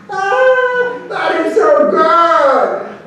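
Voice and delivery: very high, drawn-out hoot; mock delight. Men laughing at the vaquero's claim, high mock delight. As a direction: howl it out slowly as if highly amused.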